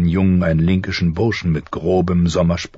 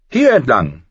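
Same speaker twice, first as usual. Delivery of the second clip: prince leading the way; 5 semitones higher, 4 dB louder than usual. A narrator's reading. Prince leads the way.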